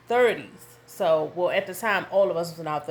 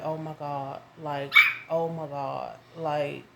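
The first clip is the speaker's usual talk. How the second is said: stunned; 2 semitones lower, 4 dB louder than usual. Retold or imitated. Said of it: "hand over my mouth".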